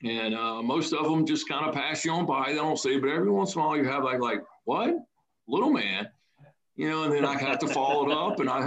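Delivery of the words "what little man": put-on surprised kid. Kid reacting to nickname.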